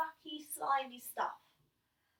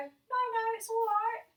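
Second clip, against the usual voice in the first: higher, mildly embarrassed brush-off; reluctant refusal; 10 semitones higher, 5 dB louder than usual. Retold or imitated. Boy refusing his brother's help, higher and sheepish. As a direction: politely wave away the help. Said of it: "At first I go".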